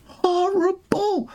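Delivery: "Horrible" held long and high in disgust; drawn-out horrible. Shown as a demonstration. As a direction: draw out "Horrible" in a high, slow mocking whine. Repeated word, drawn out high.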